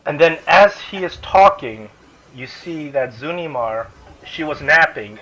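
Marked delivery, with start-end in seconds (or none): none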